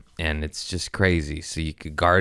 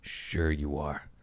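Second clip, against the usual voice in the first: slow, soft, dripping disbelief; sarcastic; 4 semitones lower, 4 dB quieter, much slower than usual. Real, not acted. Doubting a medicated person's claim, slow sarcasm. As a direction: sure you are, drawn out and doubtful. Sarcastic reply to fake happiness.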